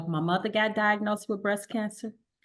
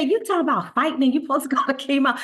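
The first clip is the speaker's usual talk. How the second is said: louder, fast, incredulous pushback; exasperated husband; 4 semitones higher, 5 dB louder, much faster than usual. Retold or imitated. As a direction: fire it back at her in disbelief. Husband incredulous, pushing her to chemo.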